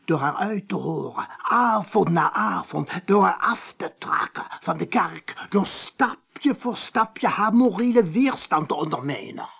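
agitated accusation. Acted. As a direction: rail against the party, building. Priest's rising accusation of the party.

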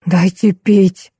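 slow, rasped plea; hoarse croak. Acted. Colonel stuck in the barrel begging for water, hoarse croak. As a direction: croak it out, parched. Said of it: "прохрипел полковник".

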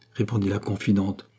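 ordinary delivery

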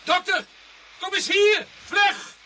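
shouted high and loud across the garden; urgent shout. Acted. Constable calling sergeant and doctor to the body, loud urgent shout. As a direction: yell for help, urgent and alarmed.

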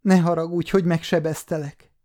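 high, tearful begging; sobbing plea. Acted. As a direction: plead through tears, frightened. (zokogva, magas hangon)

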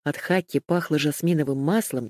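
plain reading voice

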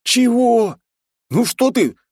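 high, loud outraged question; indignant outburst. A narrator's reading. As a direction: protest in disbelief. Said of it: "возмутился я".

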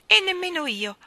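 put-on young page. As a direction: speak like an eager young page. Page refuses the cat.

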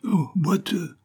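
high, far-carrying call; a sudden high hail down the road. A narrator's reading. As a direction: call out to the stranger from afar. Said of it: "lui cria-t-il".

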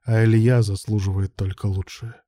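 ordinary delivery

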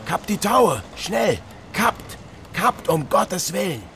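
desperate captain yelling high above the gale; urgent high-pitched commands, pleading at the end; shouting orders to the crew as the ship tips; frantic orders. Acted.

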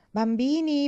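loud high call with a falling tune. A narrator's reading. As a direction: call the children to bed. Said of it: "chiamò missis Parker". (richiamo forte, tono discendente)

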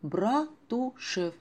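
Братушев stretched very slowly, lingering. Acted. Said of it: "вы только вслушайтесь".

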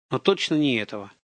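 ordinary delivery, nothing marked

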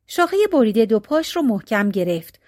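usual speaking voice

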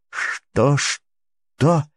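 high, rising "what, what"; urgent asking. Acted. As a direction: press for an answer, alarmed. Urgent repeated question.

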